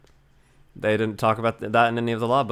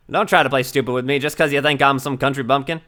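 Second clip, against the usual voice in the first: ordinary talk, nothing marked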